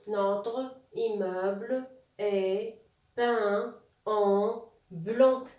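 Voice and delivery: very slow, word by word; drawn-out dictation with gaps between words; slow model reading. Shown as a demonstration. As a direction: stretch every word out for beginning readers. Teacher rereads the whole sentence.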